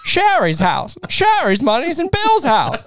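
put-on very high, frantic old film banker; hammed-up vintage screen voice; old-time movie talk. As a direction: plead like a flustered movie banker. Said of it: "why are we talking like that?".

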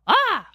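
high, sharp startled yell; a sudden yelp of pain; mock yell. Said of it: "dropped it with a yell".

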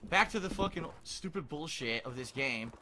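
normal talking voice

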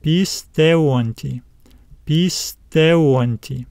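"pisteonti" drawn out slowly in parts, pis, teon, ti; slow teacher's demo of "pisteonti", stress on TE; slow sounding-out. Shown as a demonstration. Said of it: "Now let's add this suffix to it".

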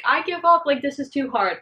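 high, loud whiny giving up; mock despair. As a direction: whine it like you're quitting. Voicing someone giving up.

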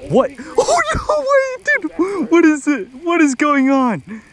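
high laughing disbelief; yelling in disbelief; shocked shout. Real, not acted. Line snapped again.

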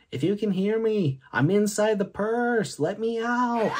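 put-on high-pitched pleading child; small boy begging to be let out; little boy voice. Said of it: "It's the sound of a little boy saying".

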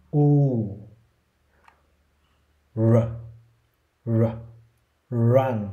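"U, r, r, run": a rounded u, held r, then run, each step separated by pauses; three-step r drill. Shown as a demonstration. Teacher drills run in three counted steps. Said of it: "mình sẽ giơ tay để chỉ là các bước một, hai, ba".

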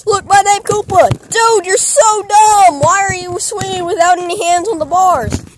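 high, loud scolding yell; exasperated shouting. Acted. Friend yells at Koopa swinging without hands, exasperated shout. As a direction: shout it in alarmed disbelief.

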